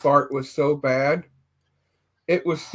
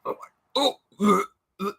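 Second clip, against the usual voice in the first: strained groan; 4 semitones higher, 4 dB quieter than usual. Retold or imitated. Straining on scooter, retold groan.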